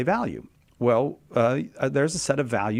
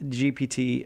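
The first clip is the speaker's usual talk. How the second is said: ordinary talk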